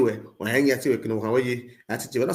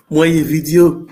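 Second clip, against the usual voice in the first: loud; 10 dB louder than usual. Real, not acted. Loud after laughing.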